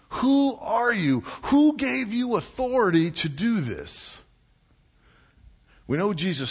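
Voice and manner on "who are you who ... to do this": indignant challenge. Retold or imitated. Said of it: "the only question you can ask".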